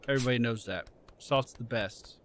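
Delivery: ordinary talk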